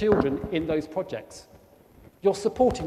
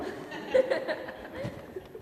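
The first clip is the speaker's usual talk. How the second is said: breathy laugh; 8 dB quieter than usual. Real, not acted. Laughing at his own joke.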